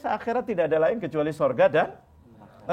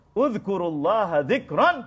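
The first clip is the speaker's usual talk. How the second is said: Quranic recitation; 3 semitones higher, 3 dB louder, slower than usual. Shown as a demonstration. Reciting Quran verse in sermon.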